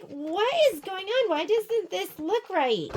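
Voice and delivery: high-pitched mock exasperation, last words drawn out; retold bafflement. Acts out her past puzzlement.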